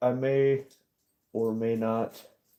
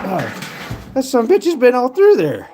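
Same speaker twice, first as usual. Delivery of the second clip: ordinary talk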